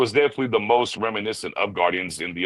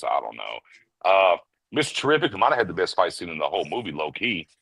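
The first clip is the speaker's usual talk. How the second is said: ordinary delivery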